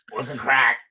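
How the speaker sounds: put-on catchphrase voice in Irish accent; attempted Irish character impression; Viper. Host prompts comedian to do his character, put-on Viper voice. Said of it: "can you do Viper?".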